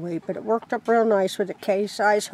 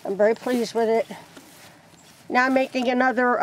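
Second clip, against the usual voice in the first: ordinary delivery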